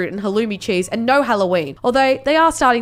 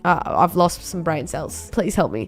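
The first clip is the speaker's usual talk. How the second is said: sick and weary; 7 semitones lower, 4 dB quieter than usual. Real, not acted. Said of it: "I'm incredibly sick right now".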